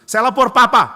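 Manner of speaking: loud high threat; angry threat. Acted. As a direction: threaten to tell dad. Child threatens teacher.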